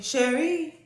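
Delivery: Sherry lilted high in imitated Trini accent; Trini singsong accent. Showing how someone says the name, Trini lilt. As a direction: sing the name upward, Trini-style. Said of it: "That's how they're saying the person's name".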